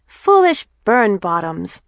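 put-on high, loud, slow child's insult; cheeky seconding. A narrator's reading. Frances seconds her sisters' scorn, cheekily. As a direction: relish each naughty word. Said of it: "seconds Frances".

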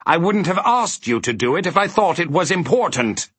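high, testy villain rant; cross, exasperated outburst; angry snarl. A narrator's reading. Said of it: "he snarled angrily".

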